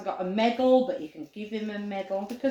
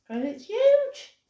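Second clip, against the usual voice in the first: playful amazement; 12 semitones higher, 3 dB louder, slower than usual. Acted. Exaggerating size of dog tongue.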